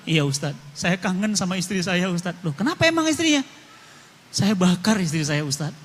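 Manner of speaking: low and soft, a grieving man close to tears; mild imitation of a weeping prisoner; understated sorrowful voice of the prisoner; tearful confession. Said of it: "Itu nangis dari mulai awal".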